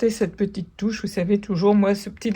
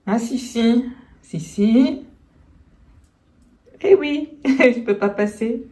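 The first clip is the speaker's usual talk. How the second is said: high, bright coaxing to the animal; talking to the pet; 3 semitones higher, 5 dB louder than usual. Real, not acted. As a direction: coo at the cat in the way. Talks to pet lying on floor. (parle à sa chatte couchée par terre, voix aiguë câline)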